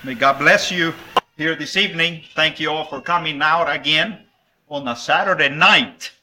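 ordinary talk

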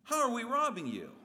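high, loud indignant protest; protesting people. Acted. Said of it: "your response is the same response of the people".

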